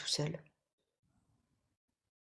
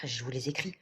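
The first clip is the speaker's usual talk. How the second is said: ordinary delivery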